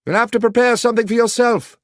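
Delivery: high, loud call from below; called back. A narrator's reading. Holmes calls back from the basement, loud and high. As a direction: shout it back up the stairs. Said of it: "he called back".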